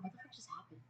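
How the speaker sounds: whispered in disbelief; shocked whisper to herself; stunned whisper. Retold or imitated. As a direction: breathe it out in shock. Stunned thought after the prank.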